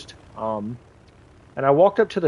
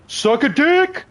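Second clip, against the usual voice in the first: high, excited taunt yelled at the enemy; gleeful taunt; 10 semitones higher, slower than usual. Real, not acted. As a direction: throw it at them, gloating, pitch way up. Gloating at enemy in game.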